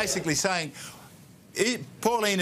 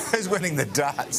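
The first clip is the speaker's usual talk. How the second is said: laughing. Real, not acted. Host laughing at darts noise.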